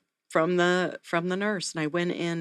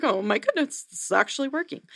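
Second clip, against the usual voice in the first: rising, higher, delighted disbelief; higher, lighter retold amazement with a laugh before it; amazed relief; 8 semitones higher than usual. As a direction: let it lift with surprised joy as it works. Sudden relief, baby finally settling.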